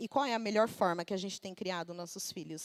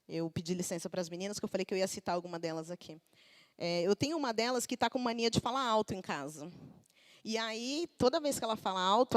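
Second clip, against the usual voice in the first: normal talking voice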